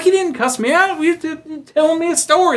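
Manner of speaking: put-on young [name] shrugging off the worry; younger self. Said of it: "I'm like".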